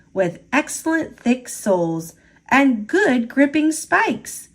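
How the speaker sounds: usual speaking voice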